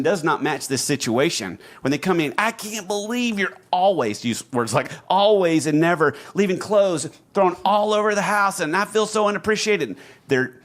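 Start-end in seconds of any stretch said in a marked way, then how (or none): 2.4-10.0 high, whiny mock complaint; mock upset spouse. Host mimicking a touchy spouse complaining, high and whiny. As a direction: ham up the aggrieved spouse.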